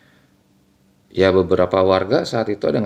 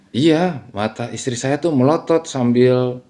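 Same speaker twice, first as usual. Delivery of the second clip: usual speaking voice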